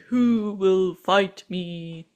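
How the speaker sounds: huge, very slow booming giant; Goliath's challenge. Retold or imitated. Giant pickle challenging the army, slow and imposing. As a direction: draw out every word like a lumbering giant.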